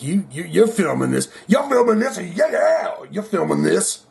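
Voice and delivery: mock yelled accusation at the cameraman; accusing Gene. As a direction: point and call him out. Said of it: "looking at this cameraman right away like".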